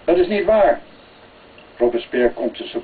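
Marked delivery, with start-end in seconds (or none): 0.1-0.8 higher-pitched objection; mild protest. A narrator's reading. Charles contradicts the king, mildly protesting. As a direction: push back with a lifted voice. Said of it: "Charles protesteert".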